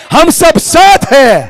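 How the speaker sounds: slow, high and loud slogan; rallying shout. Real, not acted. Speaker leading the gathering in a slogan, rallying shout. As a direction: belt out each word for the crowd.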